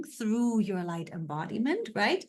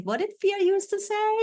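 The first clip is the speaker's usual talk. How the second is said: much higher, squeaky parody of a worried voice; mock fear voice; 12 semitones higher, 4 dB louder, faster than usual. Coach mocking how her fear used to sound, high squeaky voice. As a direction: poke fun at the old fearful self, voice up high. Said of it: "I'm like".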